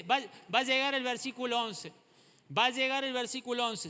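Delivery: loud, high, fervent encouragement; said with gusto. Shown as a demonstration. Urging the weeping neighbour, a loud fervent cry. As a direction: call it out with conviction. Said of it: "Decírselo con ganas".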